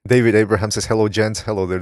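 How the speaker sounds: usual speaking voice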